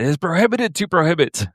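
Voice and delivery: high, quick imitation of a ban; mock official objection. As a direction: rattle off the quote in a high mock voice. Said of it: "he is the one that said".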